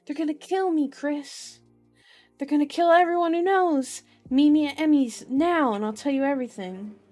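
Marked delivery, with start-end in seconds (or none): 0.1-5.7 loud, high, urgent plea; desperate pleading. A narrator's reading. Billy warning Chris they'll be killed, desperate pleading. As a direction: plead desperately, pitched up and forceful. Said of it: "Billy's pleading, desperate words".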